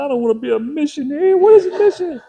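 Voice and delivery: very high, loud mock-tearful wail; bawling kid, hammed up for laughs; wailing little boy. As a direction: imitate a child protesting at full volume. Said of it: "he got tears running his face".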